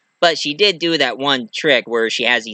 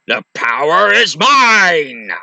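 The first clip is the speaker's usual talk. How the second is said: villain boast; 2 semitones higher, 3 dB louder, slower than usual. Retold or imitated. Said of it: "he was like".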